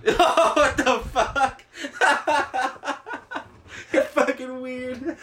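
laughing. Real, not acted. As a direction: crack up while talking. Reacting to friend's odd confession.

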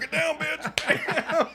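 very high, drawn-out yell, half laughing; loud comic shriek of command; mock yell. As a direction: scream the line slowly, hammed up. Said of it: "you're gonna yell".

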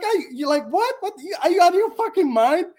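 high-pitched, sputtering disbelief; stammer, then burst out in outraged disbelief; worked-up, high mock protest; acted incredulity. Acts out disbelief at friend's advice.

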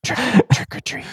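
breathy, stammered hush; ASMR whisper. Acted. Trick-or-treating in ASMR voice, giggly whisper. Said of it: "questions in an A S M R voice".

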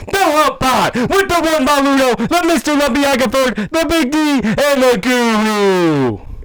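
high, slow showman call; ringmaster. Acted. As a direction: draw it out like a ringmaster. Outro announcer bit.

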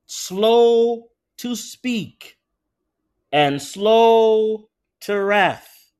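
stretched, weighty scripture reading; slow emphatic reading. Shown as a demonstration. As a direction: drag out each phrase. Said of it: "I'll read it again".

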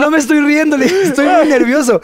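protesta nerviosa recreada, más aguda; justifícate con nervios, en tono agudo de anécdota; nervioso. Retold or imitated. (imitando su propio apuro nervioso)

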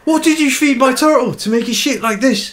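loud, high West Country accent. Shown as a demonstration. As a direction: exclaim it like a West Country local. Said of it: "Ti West Country, West Country".

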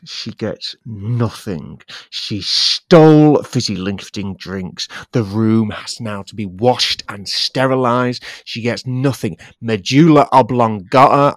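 hammed-up rant, building to loud; screaming Wonka. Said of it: "he's screaming at both you and your grandfather".

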